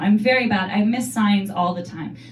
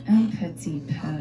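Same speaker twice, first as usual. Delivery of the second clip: slow, low French reply; mock-seductive French; 6 semitones lower, 3 dB quieter, slower than usual. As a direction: draw out the French, low and teasing. Acted sultry French answer in bed.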